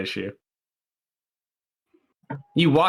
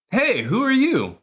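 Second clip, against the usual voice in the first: much higher, drawn-out guard's hail; looping guard challenge; 11 semitones higher, 3 dB louder than usual. Acted. Guard looks back and challenges the player, looping guard challenge. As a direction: stretch it out high, like a game character's stock challenge.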